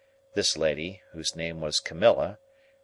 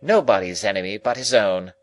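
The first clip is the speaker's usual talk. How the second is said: abrupt lady; 2 semitones higher, 6 dB louder than usual. A narrator's reading. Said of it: "with an abruptness of manner".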